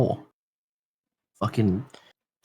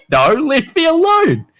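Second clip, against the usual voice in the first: high, drawn-out whiny protest; slow, high-pitched mock whine; mock pleading; 13 semitones higher, 3 dB louder, slower than usual. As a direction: beg off in a hammed-up squeal. Acting out his protest to mate.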